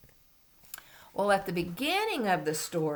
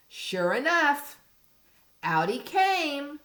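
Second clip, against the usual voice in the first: higher, louder and slow reveal; dramatic reveal; 8 semitones higher, 6 dB louder, slower than usual. Acted. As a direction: announce it with big drama. Story climax read aloud.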